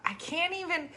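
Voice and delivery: normal talking voice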